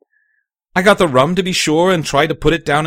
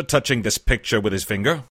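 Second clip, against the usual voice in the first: ordinary delivery, nothing marked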